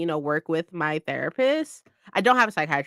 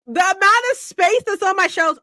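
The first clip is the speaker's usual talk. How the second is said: excited shelves; 12 semitones higher, 4 dB louder than usual. Real, not acted.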